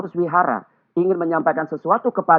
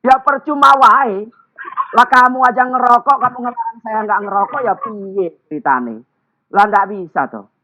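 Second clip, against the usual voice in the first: indignant retort; 5 semitones higher, 5 dB louder than usual. Retold or imitated. Listener retorts in Javanese.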